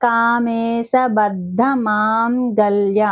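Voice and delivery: కామేశబద్ధ మాంగళ్య drawn out slowly; slow careful recitation. Shown as a demonstration. Teacher reciting the name slowly for learners. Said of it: "మాం అన్నప్పుడు రెండు పెదాలు ఇలా కలవాలన్నమాట".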